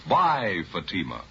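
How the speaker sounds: normal talking voice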